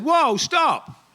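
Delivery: high, loud interrupting cry; retold shout. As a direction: shout to halt someone mid-flow. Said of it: "he shouts out in the middle of the video".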